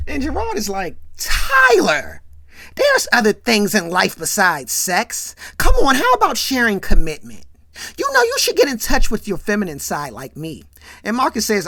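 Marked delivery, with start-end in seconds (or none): 1.2-10.6 exasperated scolding. Retold or imitated. Friend urging his buddy toward commitment, exasperated scolding. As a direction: call him out, then lecture loudly.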